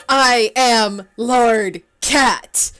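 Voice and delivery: gasp, then very slow and loud; a breathless, awestruck royal decree; gasping grand declaration. Acted. Gasped dramatic self-naming.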